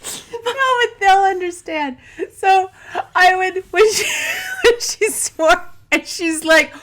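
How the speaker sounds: high, loud, squeaky with laughter; real laughter breaking up the story; helpless laughing. Real, not acted. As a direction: struggle to tell it while cracking up. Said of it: "that's why I was laughing".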